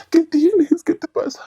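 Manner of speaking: retold uncle's alarmed whisper; worried uncle. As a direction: ask in a breathy rush like a worried uncle.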